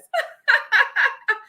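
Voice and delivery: high, loud burst of laughter; delighted laugh. Real, not acted. Host overwhelmed after the masterclass, delighted laugh.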